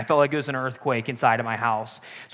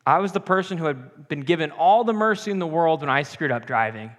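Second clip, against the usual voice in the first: normal talking voice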